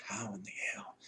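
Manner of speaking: soft whisper, barely voiced; hushed and bewildered, carrying the body; whispered disbelief. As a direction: breathe it out, stunned. Disbelief while carrying her.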